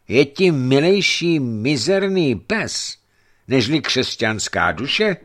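ordinary delivery, nothing marked

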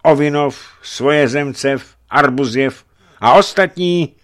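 high, loud roll-call of allies; boyar's rousing pledge; exclaiming. A narrator's reading. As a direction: call the names out keenly. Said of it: "zvolal Borecký".

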